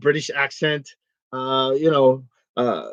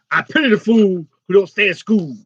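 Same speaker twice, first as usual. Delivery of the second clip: Mister T impression. Host imagines Mister T urging kids to stay in school, tough-guy voice. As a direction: growl out the pity line like Mister T. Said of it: "I'm Mister T".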